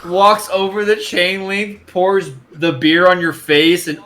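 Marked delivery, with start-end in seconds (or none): none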